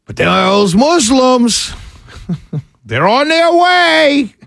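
slow, high mock warning; sarcastic. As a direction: drag it out with a laugh. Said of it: "Tongue-in-cheek sarcasm".

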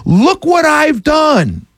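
a politician shouting slogans, slow high mock yell. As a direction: yell it slowly like a politician bragging to the street. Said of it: "and yell".

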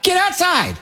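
high, mock-stern parent calling kid outside; mock parent yell. Host imitating a parent ordering kids outside, mock-stern. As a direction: playfully mimic a parent shooing a kid out.

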